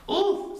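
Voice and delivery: little squeaky oof from a slap; high cartoon grunt of impact; squeaked oof; high-pitched oof. A narrator's reading. Cub slapped on the back.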